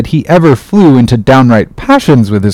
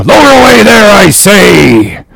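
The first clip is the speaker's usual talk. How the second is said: shouting across the deck; 9 semitones higher, 4 dB louder than usual. A narrator's reading. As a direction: bellow the order to the far boats. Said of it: "shouting across the deck".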